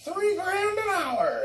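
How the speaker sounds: very high, slow falsetto demand; wife naming her price in a hammed-up falsetto; mock greedy wife. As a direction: squeak out the price like a spoiled diva. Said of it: "she's like".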